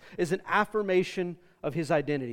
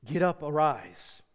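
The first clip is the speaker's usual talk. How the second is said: tender Father's call; 5 semitones lower than usual. Acted. Father calls his Son up on resurrection morning, low and tender. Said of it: "hearing the Father's voice again saying".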